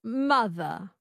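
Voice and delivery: mother slow, transatlantic, no R at the end; non-rhotic transatlantic mother; transatlantic accent. Shown as a demonstration. Learner repeats after coach, transatlantic accent. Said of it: "you drop the R".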